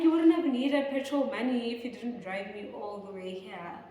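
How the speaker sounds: loud indignant retort; angry retort. Retold or imitated. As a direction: tell him off, fed up. Angry retort about petrol.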